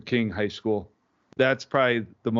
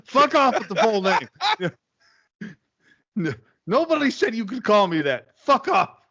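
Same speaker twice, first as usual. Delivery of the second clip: louder, much higher hammed-up fuck off; mock outrage; 9 semitones higher, 4 dB louder than usual. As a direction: fake fury over the full name. Reacting to his full name.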